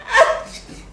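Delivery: airy laugh; breathy high laugh. Real, not acted. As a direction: burst out laughing. Bursts out laughing.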